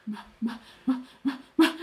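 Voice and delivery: soft speechless stuttering; flustered stammer. Retold or imitated. Man flustered by seduction, soft helpless stammering. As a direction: stammer softly, unable to form a word. Voices Richard flustered, lost for words.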